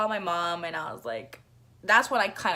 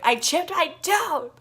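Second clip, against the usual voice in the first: high, very slow drawn-out wail; sobbing; 10 semitones higher, 8 dB louder than usual. Retold or imitated. Repeating to her mom, a very slow sobbing wail. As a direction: stretch it into a sob.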